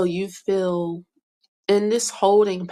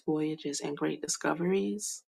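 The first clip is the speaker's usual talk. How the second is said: usual speaking voice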